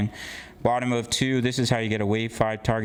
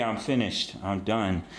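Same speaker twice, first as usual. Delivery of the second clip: usual speaking voice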